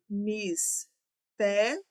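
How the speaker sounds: mistério stretched slowly, syllable by syllable; drawn out slowly. Shown as a demonstration. Teacher to class, stretching mistério slowly to split syllables. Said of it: "teacher stretches word to split it".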